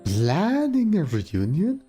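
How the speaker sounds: high, slow mystic fortune teller. Pretend. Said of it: "Fortune telling".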